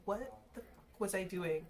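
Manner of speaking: ordinary talk, nothing marked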